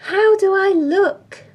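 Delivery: high, loud, grand question; mother showing off. Acted. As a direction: sing it out for admiration. Dressed-up mother presents herself.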